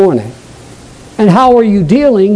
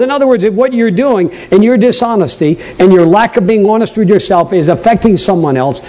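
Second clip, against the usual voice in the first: normal talking voice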